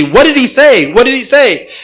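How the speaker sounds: lightly acted, excited questioning; eager reporter. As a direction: imitate a reporter pressing for the answer. Eager reporter quizzing boy in story.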